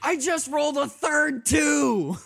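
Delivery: high, loud and slow outrage; disbelieving shout. Real, not acted. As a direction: cry out in disbelief. Disbelief at bad dice.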